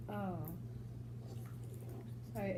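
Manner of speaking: normal talking voice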